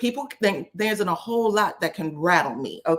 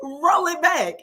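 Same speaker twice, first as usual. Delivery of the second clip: put-on higher, disbelieving "girl" with a laugh; incredulous call-out; 5 semitones higher, slower than usual. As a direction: call her out, amused and disbelieving. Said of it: "they literally look at her like".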